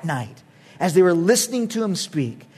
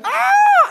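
a shrill high shriek of fright at a cat; mock scream; 5 dB louder than usual. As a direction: yelp like you just saw a cat. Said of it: "he's like".

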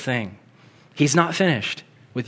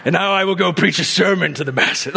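Ken Graves; 4 semitones higher than usual. Acted. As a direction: grandly proclaim it in a mock-heroic voice. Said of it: "I'm doing a Ken Graves voice".

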